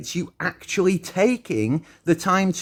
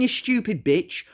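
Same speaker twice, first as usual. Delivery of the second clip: ordinary talk, nothing marked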